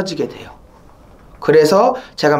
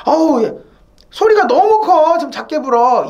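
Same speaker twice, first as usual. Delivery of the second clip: high, louder mock complaint about the noise; nagging neighbor; 8 semitones higher, 3 dB louder than usual. Neighbor scolding a sax player for the noise, mock shrill complaint. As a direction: act out a neighbor griping the sax is too loud. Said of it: "이 소리 때문에".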